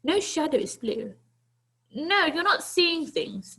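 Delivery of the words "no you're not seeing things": usual speaking voice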